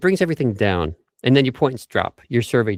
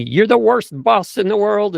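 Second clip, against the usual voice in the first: mock complaint; 8 semitones higher, 4 dB louder than usual.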